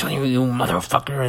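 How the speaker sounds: hammed-up outraged cursing; dramatic. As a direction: curse someone out with mock drama. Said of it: "Like, dramatic is".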